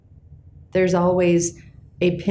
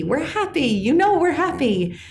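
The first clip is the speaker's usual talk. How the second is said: Joy clinging to happiness; mock insistent Joy; 9 semitones higher than usual. Joy insisting everything is fine.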